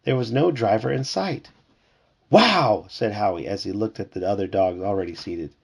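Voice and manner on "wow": higher, louder "wow"; amazed puppy. A narrator's reading. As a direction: exclaim it in wonder.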